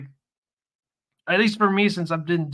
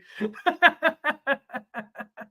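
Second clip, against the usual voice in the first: high, helpless real laughter; extended high-pitched cracking up; long laugh; 11 semitones higher than usual. Real, not acted. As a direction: laugh hard and long at your own joke. Laughs at own joke.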